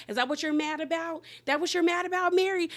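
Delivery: high-pitched retold challenge, climbing; mock argumentative yelling. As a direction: imitate a heated, rising confrontation. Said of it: "So they yelling".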